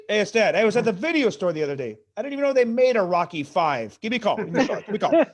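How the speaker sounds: doing dad's rambling voicemail. Retold or imitated. Son mimics dad's voicemail message, mildly loud. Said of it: "So I'll get the voicemail".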